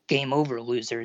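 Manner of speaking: ordinary delivery, nothing marked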